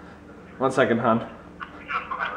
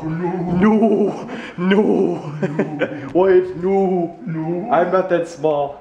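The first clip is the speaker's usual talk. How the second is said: Ricky impression; 4 semitones higher, much slower than usual. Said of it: "let's do our best Ricky impression".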